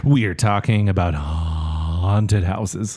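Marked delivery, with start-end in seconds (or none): 1.1-3.0 spooky announcement. Pretend. Announcing episode topic.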